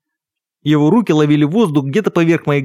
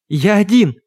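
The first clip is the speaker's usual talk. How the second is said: high, urgent protest; pleading, higher-pitched confession; desperate insistence; 6 semitones higher, slower than usual. A narrator's reading. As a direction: insist with a frightened, raised pitch. Threatened victim insists he is alone.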